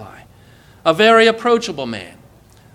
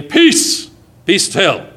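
commanding calm; 3 dB louder, much slower than usual. Acted. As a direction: order the storm to stop.

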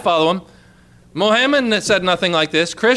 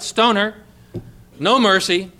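ordinary talk

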